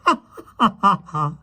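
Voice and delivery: high, staccato stage laugh; mocking witch laugh. A narrator's reading. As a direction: laugh it out high in separate ha's. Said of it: "Oriancourt laughed".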